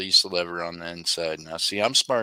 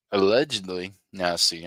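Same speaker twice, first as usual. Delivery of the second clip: ordinary talk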